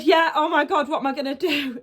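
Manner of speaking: a flustered retold outburst; retold panic. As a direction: relive the panic lightly. Retells panicked reply to brother.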